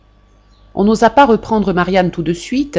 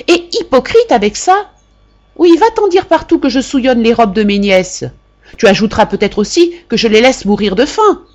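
higher and louder, sneering outrage; aunt accusing her niece of hypocrisy; furious aunt; 5 semitones higher, 5 dB louder than usual. A narrator's reading. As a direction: keep hammering the niece. Aunt keeps berating niece.

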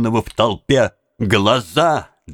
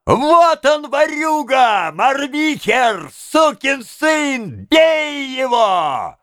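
high, drawn-out furious yell; stretched accusing shouts; howling; 13 semitones higher, much slower than usual. A narrator's reading. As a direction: howl abuse slowly, high over the crowd. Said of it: "и кто-то взвыл в небесах".

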